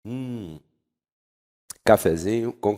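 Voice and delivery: talking between sips of coffee; sip, then speak softly; while sipping a drink. Real, not acted. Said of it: "deixa eu dar um gole aqui". (falando entre goles de café)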